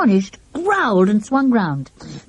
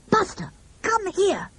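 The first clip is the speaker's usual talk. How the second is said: high, urgent call to the dog; yelling; 12 semitones higher, 6 dB quieter than usual. A narrator's reading. Children call their dog out of the fight, high urgent call. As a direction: order the dog back. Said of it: "All the children yelled at Buster".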